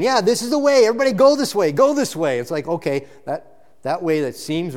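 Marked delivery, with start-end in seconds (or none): 0.0-2.4 higher, louder hammed-up call of a crowd leader; mock crowd leader. Preacher mocking the way that seems right, a hammed-up loud call. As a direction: play a cheerful guide herding everyone the wrong way.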